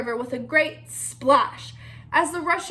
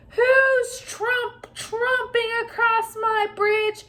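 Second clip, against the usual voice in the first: high, slow troll challenge; troll challenges the big goat crossing his bridge; troll roar; 8 semitones higher, slower than usual. A narrator's reading. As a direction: drag out the challenge. Troll roars from below.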